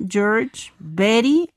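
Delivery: normal talking voice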